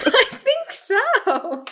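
high, drawn-out laughing reply; laughing agreement. Real, not acted. Player agreeing about the cult, high helpless laughing. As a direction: squeeze the words out through laughter.

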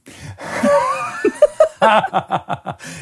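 big burst of laughter; loud laugh. Real, not acted. Laughing at kid's joke.

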